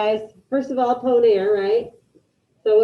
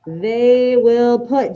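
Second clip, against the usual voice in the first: slow dictation; much slower than usual. Shown as a demonstration. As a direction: stretch each word for the class. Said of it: "Put it in the chat".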